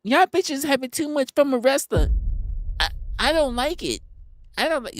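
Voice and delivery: high, mock-prissy bot voice; lightly voiced grumpy chatbot. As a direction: voice the chatbot as a fussy scold. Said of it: "That bitch woke up and said".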